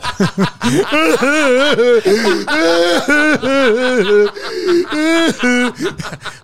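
high laughing fit; high peal of laughter. Real, not acted. Laughing at pool joke.

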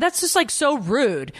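high, drawn-out indignation; retold offence. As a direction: act affronted, stretching it out. Retold indignant reply to husband.

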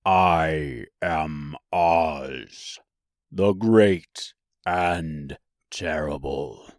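very slow, low and solemn voice; mighty wizard speaking low, very slowly; low, quiet Oz. A narrator's reading. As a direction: drag out each word deep and low. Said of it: "a low, quiet voice".

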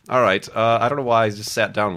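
ordinary delivery

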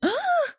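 a high, sudden shocked gasp; quick dramatic intake of breath; sharp gasp. Real, not acted. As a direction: gasp in scandalised surprise. Shocked at the idea of the crush listening.

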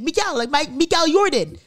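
"Miguel" as mee-KAL, pushed high; imitated accented name. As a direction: say "Jordan" as YOR-dan, mimicking him. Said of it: "he says it so weird".

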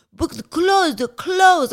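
mock-accented plea for the clothes; panicked French man. French man begging for his clothes in high panic.

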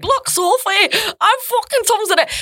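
high, quick attempt at Northern English; badly faked Northern accent. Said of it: "you need to work on your Northern accent".

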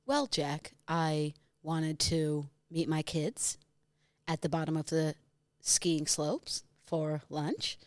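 slow, soft, lightly American Gwyneth; Gwyneth Paltrow impression. Shown as a demonstration. Asked to redo Gwyneth, slow lightly American voice. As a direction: draw each word out like a bored star. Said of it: "Go again".

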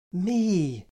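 loud, high outburst; sudden emphatic exclamation; anguished cry. A narrator's reading. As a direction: cry it out in self-blame. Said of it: "I cried".

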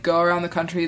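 usual speaking voice

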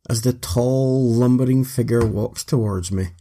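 plain reading voice

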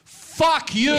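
high, loud playful curse; mock-angry loud curse at Milan; mock fuck you. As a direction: fling it back at him loudly. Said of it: "I go".

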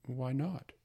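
usual speaking voice

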